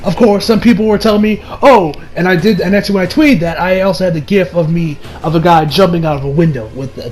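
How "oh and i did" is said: ordinary delivery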